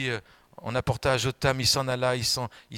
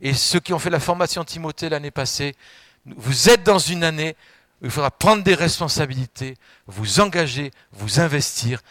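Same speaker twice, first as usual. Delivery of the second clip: exhortation; 3 semitones higher, 6 dB louder than usual. Acted. Exhorting the Timothée trainees.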